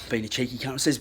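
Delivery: ordinary delivery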